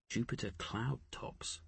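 soft, understated murmur. A narrator's reading. Poole remarking on the storm to his companions, murmured. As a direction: murmur it very softly, half to oneself. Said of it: "Poole murmured".

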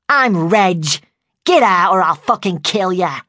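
loud, gruff masculine growl; growling threat. A narrator's reading. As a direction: growl a threat as a furious man. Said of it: "she growled".

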